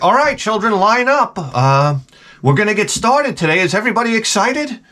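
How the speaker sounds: high, bright-eyed mock coach; overly cheerful coach, mocking it; chipper coach. Comic mocks the upbeat coach talking to kids.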